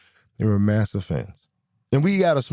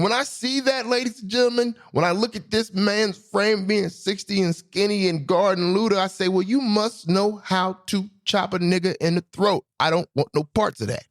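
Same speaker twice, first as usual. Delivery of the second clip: excited; 9 semitones higher than usual. Real, not acted. Excited telling to audience.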